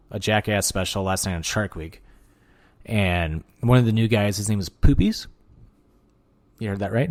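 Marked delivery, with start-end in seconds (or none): 4.4-5.3 Poopies lifted into a rising question; questioning lilt on Poopies. Shown as a demonstration. Telling viewers the new guy's silly name, rising on Poopies. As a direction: let Poopies rise at the end, as if doubting it. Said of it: "keep saying it like that".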